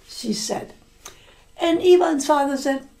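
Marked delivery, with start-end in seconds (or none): none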